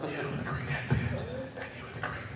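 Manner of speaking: real voiceless whisper; no voice left. Real, not acted. Host calling contestants up from the crowd with no voice. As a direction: pick people out with almost no sound, just air. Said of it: "Because I have no voice".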